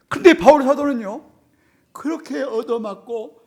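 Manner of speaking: high, loud impassioned sermon delivery; fiery preaching. Real, not acted. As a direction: preach it to the back row. Preaching at full force. (높고 큰 목소리로 열정적인 설교)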